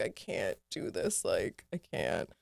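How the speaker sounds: low, slow and soft with mock despair; defeated groan. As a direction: sink into exhausted defeat. Exasperation at annoying passengers.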